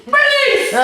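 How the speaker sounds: high, loud shriek of Police!; hammed-up shout for the police; mock yell for help. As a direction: scream it like a panicked old man. Dad yelling for police.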